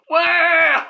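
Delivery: loud, high exaggerated "waah"; mock crying wail. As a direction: bawl like a big baby. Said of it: "This motherfucker was crying".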